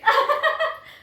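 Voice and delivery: a sudden high laugh that bursts out; burst of laughter. Real, not acted. Laughing out loud while a friend shows things on a video call. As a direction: laugh out loud, pitch jumping up.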